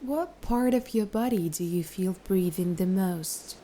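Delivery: unhurried, soothing instructions, easing off at phrase ends; guided meditation. Acted. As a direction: gently lead the listener into relaxation, letting each phrase settle.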